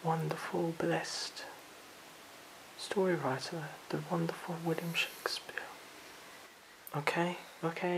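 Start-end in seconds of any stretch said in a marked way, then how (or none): 0.0-5.6 reverent whisper. Real, not acted. Reverent praise of Shakespeare.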